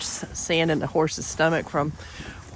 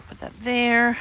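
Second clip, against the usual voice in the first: ordinary talk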